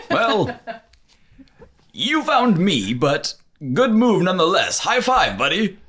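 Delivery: cheerful genie praising him. Acted. GM voices the genie.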